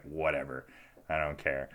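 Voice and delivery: low, quiet mock indifference; uninterested. Listener tuning out the piano talk, uninterested. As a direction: imitate someone who has checked out, low and soft. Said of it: "He was, had no interest".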